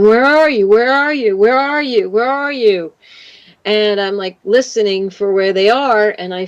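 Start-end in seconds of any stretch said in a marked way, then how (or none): 0.0-2.9 reenacted calling for lost cats; higher, singsong repeated calls. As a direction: call for missing pets, pitch arching each time. Reenacts calling her trapped cats.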